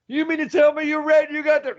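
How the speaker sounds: high, incredulous mock outburst; loud big mouth. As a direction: throw it back at the professor in disbelief.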